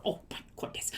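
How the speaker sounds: breathless whispered "oh my goodness"; whispered dismay. Real, not acted. Reacting to the story, whispered dismay. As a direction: hush it out in dismay.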